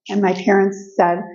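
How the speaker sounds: ordinary delivery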